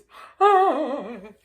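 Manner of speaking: loud burst of laughter. Real, not acted. Laughing loudly while retelling the night. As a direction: let a big laugh break out.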